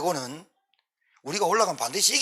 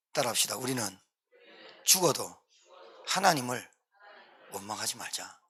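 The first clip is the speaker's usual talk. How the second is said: normal talking voice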